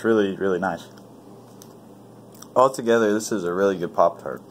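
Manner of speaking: talking while chewing; eating pastry mid-sentence; mouth full. Real, not acted. Seen 1: Bites pastry at 0.4–1.6, then talks while chewing through 4.5.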